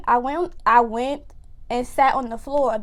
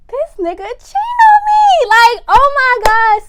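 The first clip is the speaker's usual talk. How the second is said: shocked disbelief; 11 semitones higher, 10 dB louder than usual. Retold or imitated. Discovers cheating.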